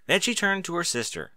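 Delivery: ordinary delivery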